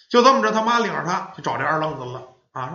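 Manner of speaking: ordinary delivery, nothing marked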